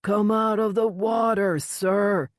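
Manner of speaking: shouting across the water; loud shout. A narrator's reading. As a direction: call out loud and high. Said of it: "raised his voice to a loud shout".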